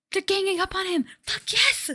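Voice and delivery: thrilled yell at the screen; excited cheer. Real, not acted. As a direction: cheer them on at full voice. Cheering as allies gang up.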